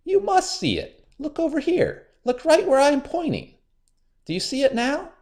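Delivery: very high, frustrated pleading; high angry Anansi; angry spider. A narrator's reading. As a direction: trickster losing his temper. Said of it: "Anansi began to get angry".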